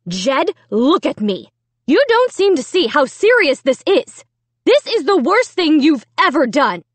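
high, emphatic telling-off; stern scolding. A narrator's reading. Mom scolds son over ruined painting, stern. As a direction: build to loud, punched words.

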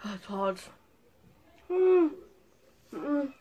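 chewing hot food; talking between bites; mouth full. Real, not acted. Seen 0: hand covers mouth mid-bite, lips working; eating throughout clip.

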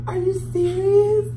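high, loud, slow disbelief; stunned, asking if it's true; disbelieving. Real, not acted. Shocked by superstition.

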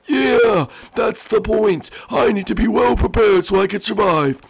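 high, half-sorry little voice; high squeaky character voice. Acted. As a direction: apologise in a squeaky voice.

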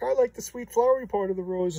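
lightly voiced gentle sister; sweet Rose voice. Reviewer voicing Rose to viewers, high and sweet.

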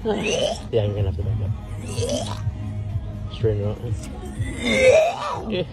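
loud. Real, not acted. Shouting directions while parking.

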